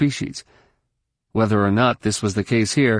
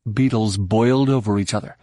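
ordinary delivery, nothing marked

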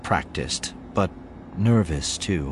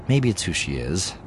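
ordinary delivery

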